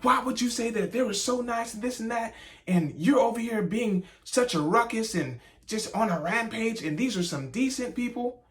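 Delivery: his own table turning on the rude diner; scolding friends. Retold or imitated. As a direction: voice the outraged table. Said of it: "His whole table turned on him".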